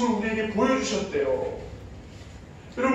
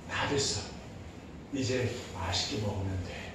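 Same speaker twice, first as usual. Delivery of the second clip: lower, much softer soothing voice; calm the scared child tenderly; gentle comfort; 10 semitones lower, 12 dB quieter than usual. Retold or imitated. (놀란 아이를 달래는 엄마의 부드러운 속삭임)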